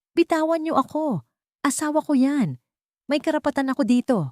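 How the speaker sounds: high, fast, desperate yelling; drunk man shouting. Acted. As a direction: yell at the guards holding you back. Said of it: "sigaw ng lalaki".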